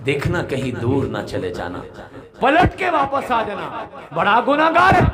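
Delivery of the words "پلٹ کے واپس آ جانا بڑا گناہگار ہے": high loud call with a laugh; God's call. Acted. God calling a sinner to return, high loud cry.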